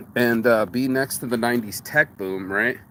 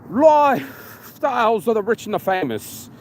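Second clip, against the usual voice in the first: put-on English announcer accent; 6 semitones higher than usual. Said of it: "this fat bastard with an English accent".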